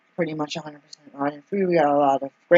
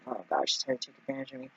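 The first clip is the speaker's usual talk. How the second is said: mock suspicious mutter; 2 semitones lower, 12 dB quieter, faster than usual. Cashier's suspicious thought, retold.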